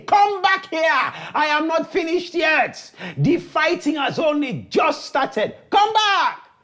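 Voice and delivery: high, insistent challenge; calling after someone. Acted. Cat chases the fleeing champion, calling out defiantly. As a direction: call out high to the runaway, stretching the first words. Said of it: "Kat chased him".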